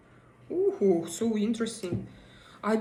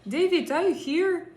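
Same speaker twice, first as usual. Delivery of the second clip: raised, high call across the room; 8 semitones higher, 5 dB louder than usual. Real, not acted. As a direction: call David in from the chat. Calling out to a viewer.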